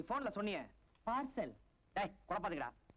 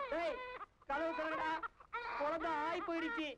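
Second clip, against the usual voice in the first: panicked calling; 7 semitones higher than usual. Acted. Baby soiled him, begging.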